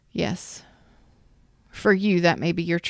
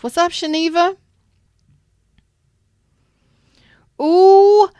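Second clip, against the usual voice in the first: squealing much higher and louder with joy; excited greeting; 9 semitones higher, 8 dB louder than usual. Real, not acted. Host greets a long-missed viewer in chat, squealing with delight.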